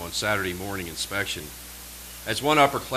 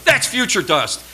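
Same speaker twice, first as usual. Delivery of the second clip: "future dust" barked loud and much higher; loud rebuke; 12 semitones higher, 8 dB louder than usual. Retold or imitated. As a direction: hammer "future dust" at the cadet. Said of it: "thick southern drawl".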